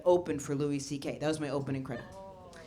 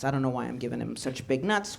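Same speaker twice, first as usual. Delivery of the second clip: normal talking voice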